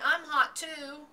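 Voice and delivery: high, loud eager cry; excited cry. A narrator's reading. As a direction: beg to be splashed too. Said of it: "cried Avery".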